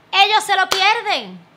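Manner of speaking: alto y fuerte, con rotundidad; proclámalo como una réplica desafiante. Retold or imitated. Réplica desafiante a quienes la rechazan. (desafiante, a plena voz)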